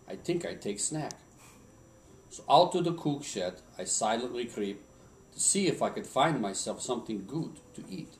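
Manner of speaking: put-on a light Swedish accent telling a Christmas poem. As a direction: recite it like an old Swedish lumberjack. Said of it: "in my best Swedish accent".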